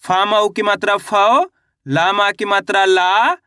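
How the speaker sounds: slow spelling drill, "फाओ" and "ला" held long and lifted; syllable-by-syllable demo. Shown as a demonstration. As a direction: stretch "फाओ" and "ला" with rising ends. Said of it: "कैसे?".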